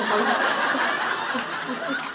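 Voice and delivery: loud, high laughing through the words; laughing fit. Real, not acted. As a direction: crack up and shout over the laughter. Laughing fit with friend Mozzie.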